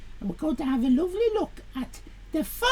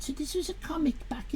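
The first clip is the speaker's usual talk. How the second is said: whisper; 2 semitones lower, 10 dB quieter than usual. Real, not acted. Whispered aside.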